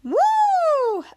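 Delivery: high, loud cheer after a laugh; celebrate, let it fly; excited whoop. Real, not acted. Cheering at the end of the recap.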